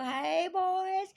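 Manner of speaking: high, loud drawn-out call. Retold or imitated. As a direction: holler to riders passing by. Calling out to passing riders.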